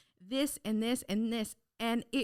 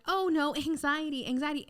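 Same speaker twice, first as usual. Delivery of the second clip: mock alarm; 5 semitones higher than usual. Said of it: "everyone I know was like".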